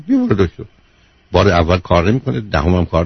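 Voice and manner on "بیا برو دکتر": a higher, coaxing plea to بیا; pleading mother. Acted. Mother begging her daughter to see a doctor, high coaxing plea. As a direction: beg her gently to go, bیا lifted high.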